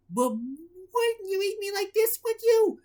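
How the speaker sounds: high nervous pleading squeak; stammering. A narrator's reading. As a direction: beg them nervously, voice rising. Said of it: "stammered Krabby Patty Bob".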